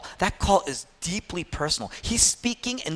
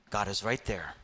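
hushed assurance; 11 semitones lower, 11 dB quieter than usual. Acted. Sermon's quiet climax.

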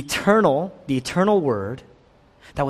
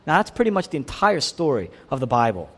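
ordinary talk, nothing marked

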